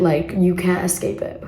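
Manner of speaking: normal talking voice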